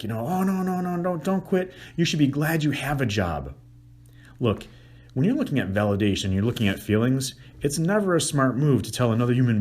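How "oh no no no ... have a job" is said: high, dismissive no no no; much higher, lecturing denial; devil's advocate. Shown as a demonstration. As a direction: brush off her feelings. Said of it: "I go into devil's advocate".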